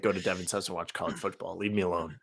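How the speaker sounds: grumpy dismissal. Retold or imitated. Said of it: "College football is like".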